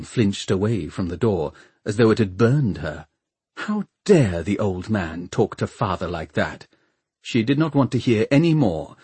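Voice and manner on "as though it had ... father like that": plain reading voice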